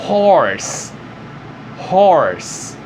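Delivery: Horse with an aspirated H at the start; English pronunciation. Shown as a demonstration. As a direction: breathe out the H in Horse. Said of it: "Esse é o som do H". (Horse com H aspirado)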